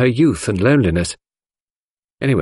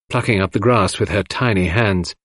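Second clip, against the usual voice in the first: plain reading voice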